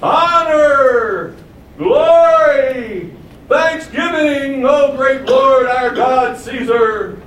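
high, loud, drawn-out acclamations; acted townspeople's cheer, stretched and soaring; crowd hailing Caesar. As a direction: shout praise as Caesar marches in. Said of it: "everybody went".

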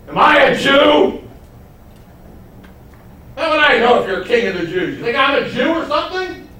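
high, loud, with a scornful laugh; sarcastic shout. Acted. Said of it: "asks a very sarcastic question".

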